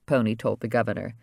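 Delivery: ordinary delivery, nothing marked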